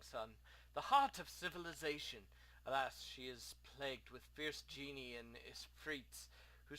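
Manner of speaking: big sighing opening, then measured lament; lightly hammed-up mournful exile; homesick merchant. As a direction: declaim wistfully about a lost homeland. Plays Abdullah mourning homeland.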